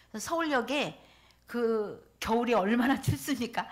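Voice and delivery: ordinary delivery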